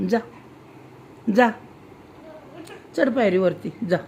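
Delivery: ordinary talk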